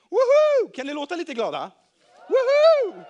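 high, loud cheer of joy; joyful whoop. A narrator's reading. Healed man jumping for joy, joyful whoop. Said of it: "han hoppa av glädje".

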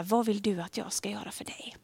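soft whispered question. Acted. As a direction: breathe the question quietly. The question recalled once more.

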